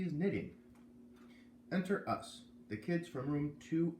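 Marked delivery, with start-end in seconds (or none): none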